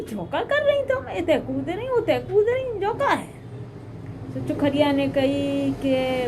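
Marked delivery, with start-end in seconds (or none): 0.0-3.3 high, mock-scolding bride; a bride teasingly scolding the hopping mouse, pitched high. Bride scolds the mouse jumping on her rotis, high and teasing.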